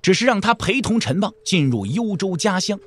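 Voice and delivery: ordinary talk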